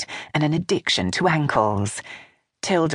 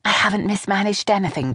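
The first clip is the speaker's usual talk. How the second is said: lightly voiced younger woman, a little higher; a hint of a nervous daughter-in-law; Vanessa voice; 7 semitones higher than usual. A narrator's reading.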